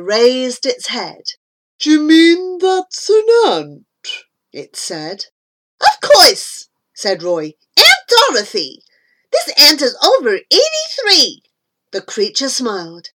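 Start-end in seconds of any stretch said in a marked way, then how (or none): 1.8-3.8 high anteater voice; high, wondering creature voice; incredulous creature. A narrator's reading. 5.8-6.7 high, loud retort; loud, high-pitched confirmation; emphatic boy. A narrator's reading. 7.8-11.4 high, loud introduction; loud, high-pitched exclamation; boy announcing. A narrator's reading. Boy announces his aunt loudly.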